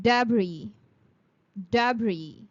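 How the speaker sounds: "debris" said DEB-ree, silent S; "Debris" with no S at the end; debris pronunciation. Shown as a demonstration. Teacher drilling debris for students. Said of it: "The next word is".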